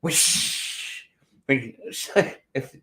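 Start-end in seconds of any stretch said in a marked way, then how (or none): 0.0-1.1 "Wish" whispered with a long hissing sh; stressed wish. Shown as a demonstration. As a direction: breathe out a drawn-out "Wish" with the sh held. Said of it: "emphasize on the wish".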